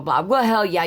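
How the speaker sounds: ordinary delivery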